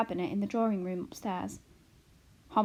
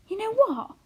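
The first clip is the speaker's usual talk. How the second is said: much higher, bursting out; sudden exclamation; 13 semitones higher, 7 dB louder than usual. A narrator's reading. Arrietty interrupting her tearful mother, a sudden eager exclamation. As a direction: blurt it out eagerly. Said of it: "exclaimed Arrietty suddenly".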